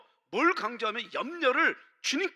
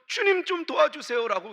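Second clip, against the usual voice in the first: crying out; 5 semitones higher, 5 dB louder than usual. Retold or imitated. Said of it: "외쳤을 때".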